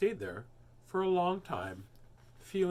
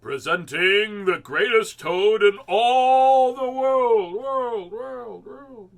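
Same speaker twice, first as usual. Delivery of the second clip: slow, booming strange voice, higher and louder, echoing away; grand echoing showman voice that fades out; far-off echoing announcer; 9 semitones higher, 15 dB louder, much slower than usual. A narrator's reading. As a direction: announce the greatest toad into a vast hall, fading echo. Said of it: "A strange voice from far away said".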